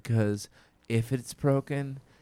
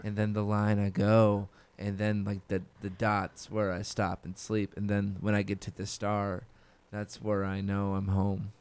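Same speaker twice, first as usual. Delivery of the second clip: hushed, slow tender recounting; slow soft storytelling; 3 semitones lower, 6 dB quieter than usual. Pretend. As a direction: tell it slowly and softly. Mock solemn answer, slow soft.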